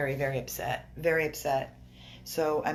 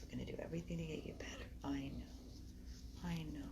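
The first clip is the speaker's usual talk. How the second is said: soothing whisper; 14 dB quieter, faster than usual. Real, not acted. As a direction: whisper gentle reassurance. Soothing the sick puppy.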